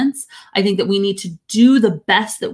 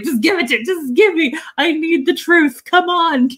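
playful mock plea, pitched up; pleading for the truth; 7 semitones higher than usual. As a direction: beg them to be honest with you. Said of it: "And I'm always like".

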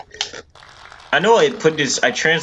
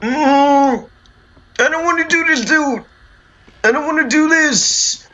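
high, whimpering fearful whine; scared whine; 14 semitones higher than usual. Real, not acted. Scared gamer whining at scene.

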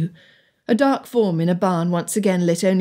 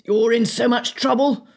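raised pitch, narrated roar without volume; mild mock roar; 4 semitones higher than usual. A narrator's reading. Henry threatening the hidden pranksters, mild roar. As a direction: threaten them with a restrained roar. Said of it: "Henry roared".

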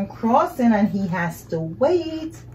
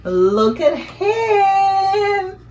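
excited; 11 semitones higher, 6 dB louder, much slower than usual. Acted. Boy with new paper.

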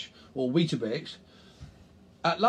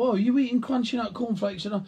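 advert voice; 7 semitones higher, 3 dB louder than usual. Retold or imitated. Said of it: "they go".

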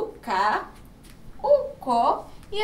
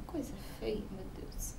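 mock disgusted whisper; 6 semitones lower, 14 dB quieter than usual. Teacher mocks dirty pig.

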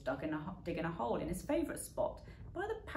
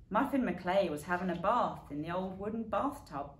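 usual speaking voice

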